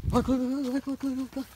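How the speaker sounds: high coaxing repeated look; high-pitched calling. Real, not acted. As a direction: coax them with a high voice. Distracting the animals.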